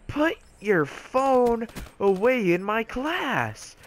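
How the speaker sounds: high, slow, drawn-out mock yell; imitating furious teacher. Teacher ordering kid's phone away, mock yelling slowly. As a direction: stretch each word in high-pitched outrage.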